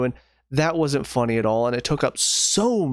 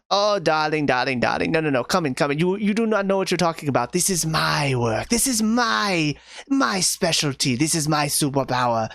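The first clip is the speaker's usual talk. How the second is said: imitating Edna Mode, mildly hammed up. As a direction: play a bossy little fashion designer who runs the room. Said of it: "the exact Edna voice".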